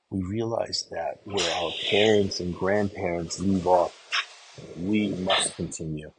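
slow, loud sermon peak, building on off; rising preacher's call, slow and loud; preaching at full force. Acted. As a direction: drive the lesson home to the congregation. Preaching climax.

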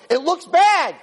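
high-pitched impassioned cry; imitated yelling, pitch pushed way up. Hashem yelling at Moshe Rabbeinu, impassioned. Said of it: "That's exactly what he was yelling".

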